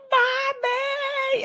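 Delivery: put-on high, drawn-out child wail; imitated child's scream. Son screaming behind the locked door, imitated high wail. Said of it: "he's screaming and he's pounding on the door".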